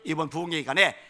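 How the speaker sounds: loud, high, building exhortation; fervent preaching. Acted. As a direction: preach the blessing at full force. Pastor's closing exhortation.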